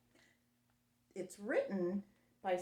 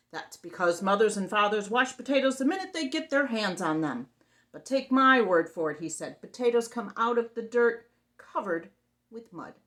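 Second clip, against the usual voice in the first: loud monster voice; 10 dB louder than usual. Acted.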